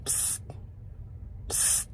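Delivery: soft, hissed psst; secretive psst. Retold or imitated. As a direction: get someone's attention on the sly. Imitates voice in his ear.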